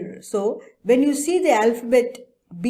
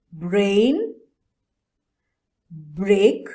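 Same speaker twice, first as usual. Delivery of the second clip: each word said loud and clear, one by one; teacher modelling words; 3 dB louder, much slower than usual. Shown as a demonstration. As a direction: call out each word for the class to repeat. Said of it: "Repeat the words after me".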